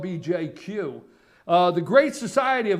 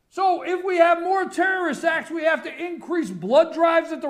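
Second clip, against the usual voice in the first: angry rant; 8 semitones higher than usual. Real, not acted. Indignant rant continues.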